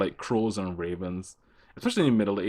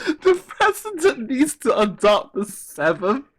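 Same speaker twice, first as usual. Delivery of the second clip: incredulous; 9 dB louder than usual. Real, not acted. Incredulous, laughing question.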